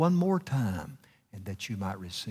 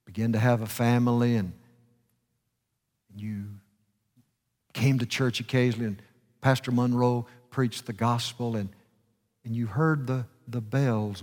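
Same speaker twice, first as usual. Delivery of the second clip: ordinary delivery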